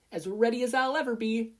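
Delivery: put-on upbeat girl bracing herself; Ochako voice. A narrator's reading. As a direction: chirp it with nervous resolve.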